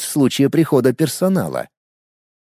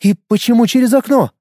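rising puzzled question; surprised; 9 semitones higher, 3 dB louder than usual. A narrator's reading. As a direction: ask in open surprise. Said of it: "удивлённо спросила девушка".

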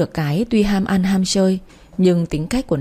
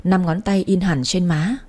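ordinary delivery

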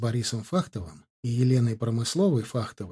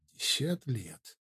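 soft, slow murmur under the breath; whisper; 11 dB quieter than usual. A narrator's reading. Ardan whispers to himself under his breath, whispering. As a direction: whisper it slowly to yourself. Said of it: "прошептал себе под нос Ардан".

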